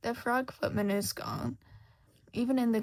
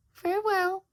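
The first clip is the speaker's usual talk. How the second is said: higher, louder sly cat voice; Cheshire Cat; 10 semitones higher, 6 dB louder than usual. Acted. Cheshire Cat answering lost Shota, sly and amused. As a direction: purr it like a teasing cat.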